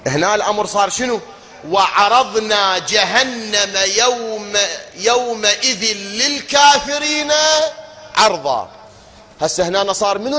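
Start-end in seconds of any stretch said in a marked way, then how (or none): 1.7-8.7 emphatic tajwid recitation; Quran recitation. Acted. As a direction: lean on للكافرين as you chant it slowly. Recites verse to contrast wording.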